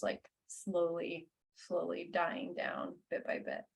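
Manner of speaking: soft, fading narration; quiet. Acted. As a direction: let it die away softly. GM describes dying fire.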